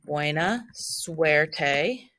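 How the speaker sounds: Buena suerte drawn out very slowly, each syllable clear; teacher modeling Buena suerte slowly for students; slow, careful Spanish. Shown as a demonstration. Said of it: "and it means good luck".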